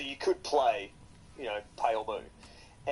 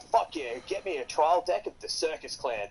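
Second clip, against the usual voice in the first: higher, eager retold cheer; mock thrilled, asking for the deck; excited quote; 6 semitones higher than usual. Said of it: "and I'm like".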